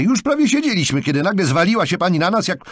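loud, high-pitched angry complaint; indignant. A narrator's reading. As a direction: rant at her in outrage. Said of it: "oburzył się".

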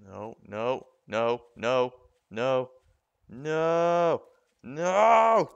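building to a loud high scream; panicked shout. Real, not acted. Zombie attack, rising panic.

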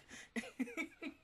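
soft giggle; quiet, high chuckle to oneself; amused little laugh. Real, not acted. Laughs at own smutty advice.

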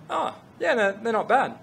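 high-pitched, noncommittal verdict; imitated lukewarm approval. Canadians looking over the jeans shown to them, imitated as lukewarm.

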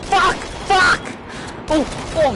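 high, loud startled screams; jolted by fright; scared shriek. Real, not acted. Jump scare in game.

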